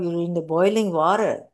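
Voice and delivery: ordinary talk, nothing marked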